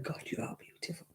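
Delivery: breathy admiring whisper; hushed swoon. Pretend. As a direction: whisper it like a dreamy compliment. Imagined admirer's compliment.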